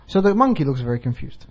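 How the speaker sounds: ordinary talk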